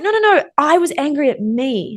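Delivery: high-pitched retold protest; insistent protest. Acted plea explaining to teacher.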